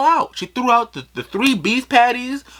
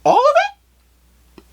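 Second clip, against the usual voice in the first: disbelief; 8 semitones higher than usual. Retold or imitated. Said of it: "I'm really like".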